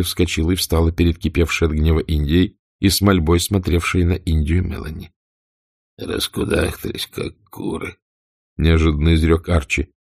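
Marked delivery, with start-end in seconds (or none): 6.0-8.0 whispered, much softer scorn; contemptuous mutter. A narrator's reading. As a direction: sneer under the breath. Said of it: "прозвучало бесконечное презрение".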